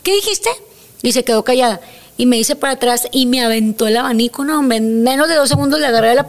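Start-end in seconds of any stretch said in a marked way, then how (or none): 0.0-0.5 high, sharp what-did-you-say; challenging retort. Retold or imitated. Niurka retelling how she confronted the diva, challenging. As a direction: throw it back as a threat.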